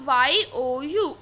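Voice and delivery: slow letter-by-letter spelling; spelled out slowly. Shown as a demonstration. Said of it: "यू को आप लिखते कैसे हो".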